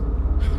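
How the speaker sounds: short voiceless burst of laughter; breathy laugh. Real, not acted. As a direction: crack up at your own slip. Laughs at own stutter.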